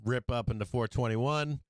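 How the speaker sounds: ordinary delivery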